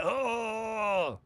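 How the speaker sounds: long, high congested "oh"; drawn-out announcer exclamation; chesty, guttural. Shown as a demonstration. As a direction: hold it as if punched in the chest. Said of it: "it's very chesty".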